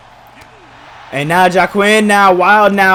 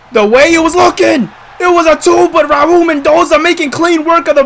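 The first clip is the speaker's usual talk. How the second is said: excited; 8 semitones higher than usual. Real, not acted. Excited play-by-play of a near pinfall.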